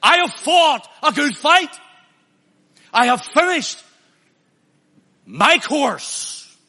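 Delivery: loud, high, ringing proclamation; a defiant stand, shouted out; bold declaration. Retold or imitated. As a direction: declare it triumphantly, full voice. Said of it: "He's taking a stand and he's saying".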